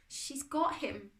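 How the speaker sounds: slow, high, breaking voice. A narrator's reading. Boy telling his friend of Dad's capture, voice breaking. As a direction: let the voice crack with grief. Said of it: "Darkus's voice broke".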